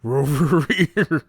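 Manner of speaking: high, loud doggy howl; slow, booming dog-voice shout; shouting cartoon dog. A narrator's reading. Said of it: "slid down the banister shouting".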